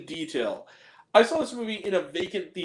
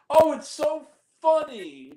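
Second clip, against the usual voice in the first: higher, slow, mock-delighted sneer; sarcastic; 9 semitones higher, 4 dB louder, slower than usual. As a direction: drip with sarcasm. Mocking a racist gag.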